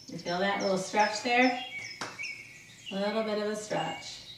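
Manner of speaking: soothing fitness coach; calm exercise instruction. Acted. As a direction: guide them through the stretches calmly. Leads a guided stretch.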